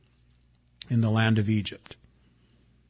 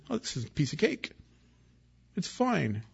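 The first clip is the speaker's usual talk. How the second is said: higher, quicker, softer breezy dismissal; mock carefree; 5 semitones higher, 7 dB quieter than usual. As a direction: brush it off like it's nothing, sing-song and light. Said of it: "He wasn't pretending".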